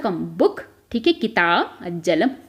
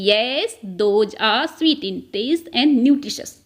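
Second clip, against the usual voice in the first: very slow, word by word for the class; slow dictation; much slower than usual. Shown as a demonstration. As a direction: spell it out slowly so students can follow. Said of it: "तो हम क्या बोलेंगे?". (धीमे-धीमे, शब्द-दर-शब्द बोलना)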